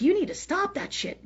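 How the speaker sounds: high-pitched demand; high sharp telling-off; high yelled order. Retold or imitated. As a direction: yell it at her again. Retold yelling at woman.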